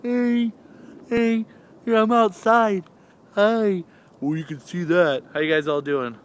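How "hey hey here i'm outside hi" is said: high, loud drawn-out hello; greeting call. Real, not acted. Vlog greeting called out outside.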